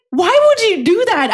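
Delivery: high-pitched retold protest; indignant reproach. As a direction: plead with him in exasperation. Retells reproaching manager after the prank.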